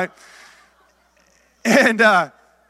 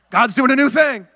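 churchy proclamation; 4 semitones higher than usual. Retold or imitated. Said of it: "that's how you talk in church".

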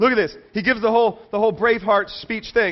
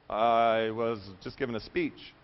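low, soft, slow embarrassed mumble; sheepish excuse; 10 semitones lower, 8 dB quieter, slower than usual. Acted. Moses excusing himself to God, low, soft and sheepish. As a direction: shrink away and excuse yourself.

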